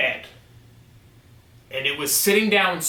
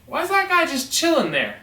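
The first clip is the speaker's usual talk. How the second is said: puzzled thought; 11 semitones higher than usual. Retold or imitated. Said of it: "And I'm like".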